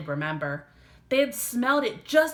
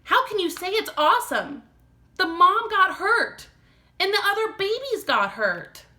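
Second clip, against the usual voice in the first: upset scolding; 12 semitones higher, 6 dB louder than usual. Acted. Upset sister scolds brother.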